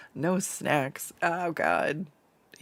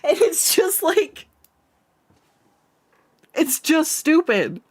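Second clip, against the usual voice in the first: high laughing delight; 13 semitones higher, 5 dB louder than usual. Real, not acted. Laughing at the joke.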